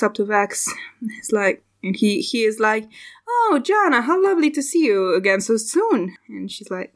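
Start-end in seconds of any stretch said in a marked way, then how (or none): 3.3-6.0 gushing, high-pitched opening that swoops down; effusive greeting. Acted. Tailor greets customer Jana back so soon, effusive.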